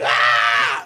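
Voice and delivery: loud mock scream of disgust; imitated startled scream. Retelling singer feeling roach on her neck, loud mock scream. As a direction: let out a sudden yell as if a roach touched you. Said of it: "she just, like".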